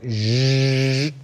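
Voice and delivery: long buzzing "zzzz" of a saw; drawn-out mimicked sawing sound; imitated saw noise. Shown as a demonstration. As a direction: buzz it out like a saw cutting through. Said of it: "took a saw and went".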